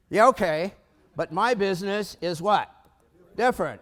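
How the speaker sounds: loud, insistent pushback; retold objection. Business owners rebuffing the banker's analysis, loud insistent objection. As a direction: push back loudly, stretching the point.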